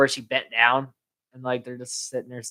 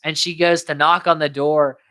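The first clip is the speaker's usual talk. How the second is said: usual speaking voice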